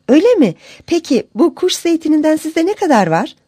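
high-pitched, eager questioning; eager curiosity. A narrator's reading. Eager buyer asks the captain about olives, high-pitched and keen. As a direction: ask keenly, pitch lifted well up. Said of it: "gözleri istekle parlayarak".

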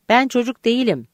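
indignant protest. A narrator's reading. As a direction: object firmly as the slighted child. Boy protests at being called child.

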